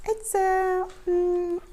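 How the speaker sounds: high, hesitant cat voice; flustered little cat. A narrator's reading. Keith fumbling an excuse in front of cats, flustered. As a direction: stumble to find the words.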